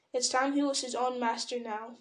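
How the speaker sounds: ordinary delivery, nothing marked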